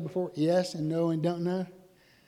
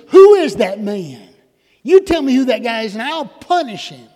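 furious, much louder and higher, bellowing the demand; outraged shout; 5 semitones higher, 15 dB louder than usual. Retold or imitated. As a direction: roar it like a king in righteous rage. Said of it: "David is so outraged".

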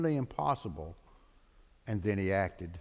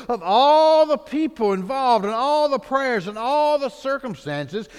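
higher, slower, growing wonder; awed preaching; 9 semitones higher, 5 dB louder than usual. Acted. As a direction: marvel aloud at God's greatness. Preacher marvels at God.

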